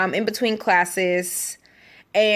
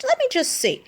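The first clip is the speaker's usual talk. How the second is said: nosy high voice; 14 semitones higher than usual. Retold or imitated. Nosy on Facebook.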